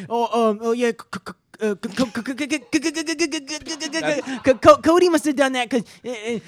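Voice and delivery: exaggerated machine-gun stutter on the C; Bobby's stuttering. Friend mimics Bobby blaming Cody, hammed-up stutter. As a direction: get stuck hard on the first sound, then push through. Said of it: "he stutters like fucking crazy".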